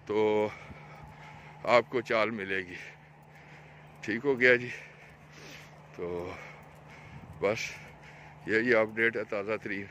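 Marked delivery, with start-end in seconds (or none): none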